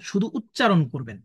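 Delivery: ordinary talk, nothing marked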